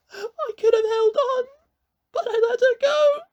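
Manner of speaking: put-on high, crying boy; sobbing. A narrator's reading. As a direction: confess through sobs. Connor sobs his confession.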